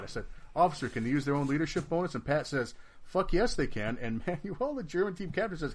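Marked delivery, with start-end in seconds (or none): 0.6-2.1 higher, louder, fast appeal; urgent question. Retold or imitated. Asking Pat about the rule, fast and loud. 3.0-4.0 high, loud, certain; emphatic yes. Retold or imitated. Pat answering the rules question, loud and sure.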